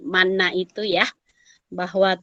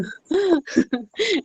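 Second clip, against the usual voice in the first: high, light chuckle; amused laugh; 8 semitones higher than usual. Real, not acted. Host to participant who dropped off the call, amused laugh. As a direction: chuckle over the mishap.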